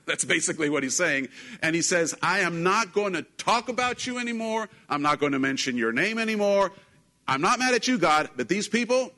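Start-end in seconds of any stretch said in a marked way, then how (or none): 2.2-9.1 higher-pitched, emphatic protest; acting out a prophet digging in his heels; frustrated refusal. Prophet protesting to God about his people, frustrated.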